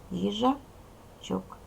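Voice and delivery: їжачок said slowly, stretching the ending -чок; slow, stretched rhyme ending. Shown as a demonstration. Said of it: "дивіться, дітки, кінцівка піджачок".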